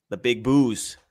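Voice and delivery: hammy fake Boston accent on "booze"; the big "booze" in a broad Boston drawl. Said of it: "we're doing a lot of fake Boston accents".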